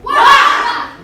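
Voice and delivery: very loud high pained shriek; villain shriek. Acted. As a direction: yelp as if struck hard. Villain hit and recoils.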